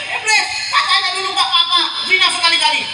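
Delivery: very high-pitched outraged complaint; acted protest. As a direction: cry out at the devil who tricked you. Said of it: "Protes sama Iblis".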